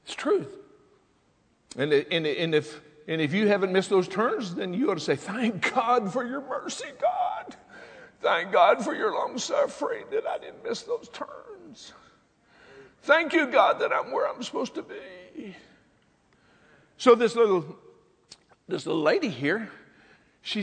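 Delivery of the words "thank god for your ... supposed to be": high, drawn-out cry of gratitude; stretched, high praying voice; fervent thanksgiving prayer. Acted. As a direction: plead your thanks up to God.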